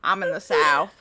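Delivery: put-on Texas accent, deep and quiet; Southern accent. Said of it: "I can do a pretty good one".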